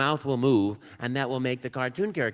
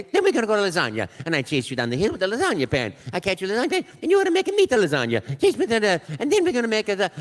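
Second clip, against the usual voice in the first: higher, louder, excited Italian cartoon plumber; Mario voice; 4 semitones higher, 5 dB louder than usual. Actor inventing pasta dishes in audition, excited Mario voice. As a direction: rattle off made-up pastas like a giddy Italian plumber. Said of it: "I enjoyed the voice".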